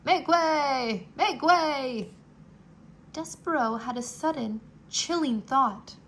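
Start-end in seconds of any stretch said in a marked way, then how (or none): 0.0-2.1 louder call to the crowd. Acted. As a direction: call it across the hall. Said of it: "the threadmaster calling out".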